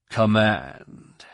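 malicious male voice in the mind; menacing voice speaking into his mind; telepathic voice. A narrator's reading. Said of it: "The voice, male, rich in expectant malice, spoke directly into his mind".

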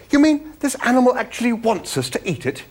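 high-pitched, incredulous outburst; appalled exclamation. A narrator's reading. Arthur learning the animal wants eating, appalled exclamation. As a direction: squeak with disbelief and horror. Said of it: "exclaimed Arthur".

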